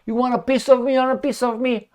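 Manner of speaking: hammed-up tough-guy challenge; mock tough guy. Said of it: "I'm like".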